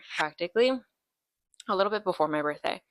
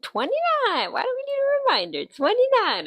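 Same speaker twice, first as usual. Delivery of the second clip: much higher, sing-song; squeaky high dodge; 5 dB louder than usual. Real, not acted. Asked her age on stream, squeaky high sing-song. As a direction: chirp the age coyly.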